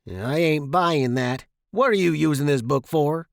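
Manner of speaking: loud, gruff interrogation; forceful accusing dad voice; suspicious father. A narrator's reading. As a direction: grill the boy like a doubting dad.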